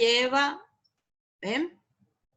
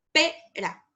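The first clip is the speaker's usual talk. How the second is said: "pera" with a high, strong PE; prosodic stress; 5 semitones higher than usual. Shown as a demonstration. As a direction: punch PE in "pera", then drop on "ra". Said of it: "esos son palabras con acento prosódico".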